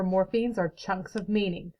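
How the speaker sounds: ordinary delivery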